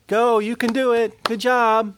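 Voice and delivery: high-pitched mock cheering from afar; sideline cheerleader. Cheerleader outside the door to someone inside, high mock cheering. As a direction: call out encouragement high, from the sidelines. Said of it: "sidelines outside the door going".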